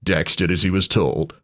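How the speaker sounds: plain reading voice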